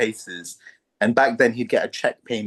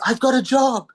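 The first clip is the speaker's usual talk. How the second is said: excited announcement; 12 semitones higher, 3 dB louder than usual. Retold or imitated. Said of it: "And she says".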